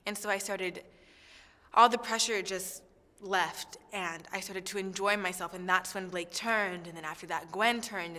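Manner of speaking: usual speaking voice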